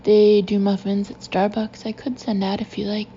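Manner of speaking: plain reading voice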